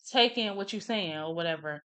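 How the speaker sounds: ordinary talk, nothing marked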